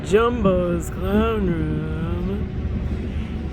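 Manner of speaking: Jumbo's Clown Room with hugely stretched vowels; Jumbo's Clown Room as a slow, showy running gag; drawn-out catchphrase. Pretend. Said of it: "say it like that every time".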